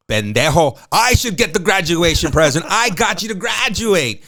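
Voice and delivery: loud, high mock scolding from an outraged mother; indignant mom outburst. Mom answers son's graduation present question, indignant high yell. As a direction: act out an exasperated mom yelling at her kid. Mom's indignant reply in retold story.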